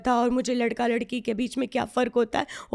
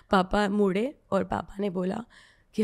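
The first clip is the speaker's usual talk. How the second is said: usual speaking voice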